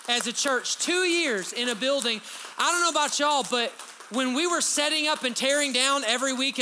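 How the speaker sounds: loud, high, rising preacher's cry; a pastor stirring his church to praise; preaching at full force. Real, not acted. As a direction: lift the room with it, full voice.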